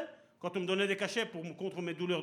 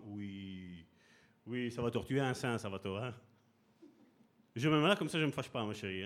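placating husband; 8 semitones lower than usual. Retold or imitated.

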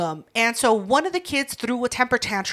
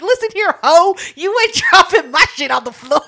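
loud, high scolding through laughter; going off; 6 semitones higher, 6 dB louder than usual. Retold or imitated. Aunt scolding a four-year-old over thrown microphone, laughing mock anger. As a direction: retell the telling-off, cracking up. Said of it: "I went off".

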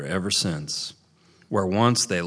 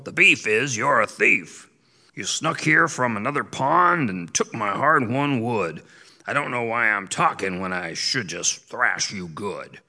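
put-on angry old beaver; 3 semitones higher than usual. A narrator's reading. Earl scolds the thief.

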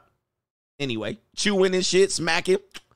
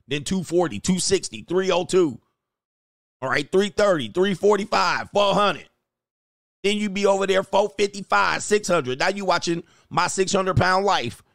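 ordinary talk

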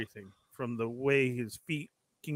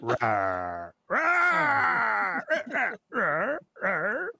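bouncy playful rawrs, one louder; dinosaur roars; 6 semitones higher, 4 dB louder, much slower than usual. Pretend. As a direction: roar like a silly dinosaur. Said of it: "Like he's dubbing a dinosaur".

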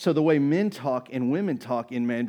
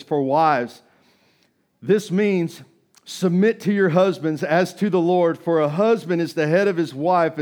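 raised, measured scripture read; 2 semitones higher, 4 dB louder than usual. Real, not acted. Pastor reads Ephesians to the church, raised and proclaiming. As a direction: proclaim the verses to the congregation.

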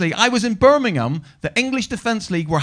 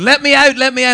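raised, higher mock cry from a dog crate; trapped boy pleading; 5 semitones higher, 6 dB louder than usual. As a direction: call out to be freed, half-hammed. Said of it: "And he was going".

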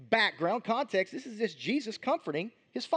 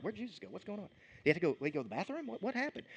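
much softer, puzzled muttering among friends; confused murmur; 9 dB quieter, faster than usual. Retold or imitated. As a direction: wonder aloud under your breath. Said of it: "they're looking around like".

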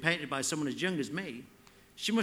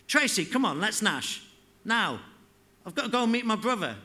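loud, higher impatient call, quickening; shouting; 5 semitones higher, 4 dB louder than usual. Acted. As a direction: yell across the roof for her to hurry. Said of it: "Pogo shouted".

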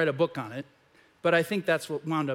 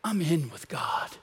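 content Jonah in the shade; 4 semitones lower, 6 dB quieter than usual. Retold or imitated. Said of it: "and he's like".

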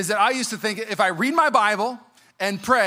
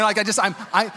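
normal talking voice

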